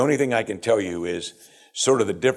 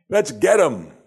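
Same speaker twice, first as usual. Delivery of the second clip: higher-pitched mob rallying call; rallying cry; 7 semitones higher, 4 dB louder, slower than usual. Acted. Crowd wanting to seize Jesus, rallying cry. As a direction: rouse the others to grab him.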